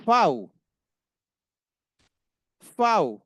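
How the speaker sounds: fow, starting with a crisp f; German V as fow. Shown as a demonstration. Teacher drilling the German letter V, emphatic. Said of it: "जो letter वी है German का".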